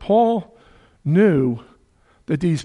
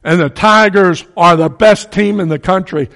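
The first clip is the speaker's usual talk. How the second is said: boastful shout; 5 semitones higher, 11 dB louder than usual. Retold or imitated. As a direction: brag it out to a hostile crowd. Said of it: "and you say".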